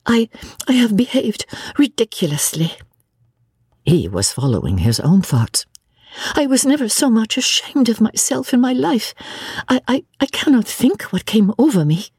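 a hint of an embarrassed young woman; ashamed Lucy. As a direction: stammer out the shame of it.

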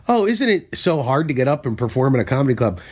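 ordinary talk, nothing marked

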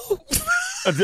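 high, delighted shriek of laughter. Real, not acted. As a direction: burst into a squealing laugh. Laughing at friend's confusion.

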